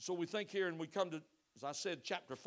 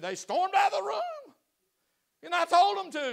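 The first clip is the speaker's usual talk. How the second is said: retold loud exclaim; 12 semitones higher, 9 dB louder than usual. Counselor retelling angry couple exit.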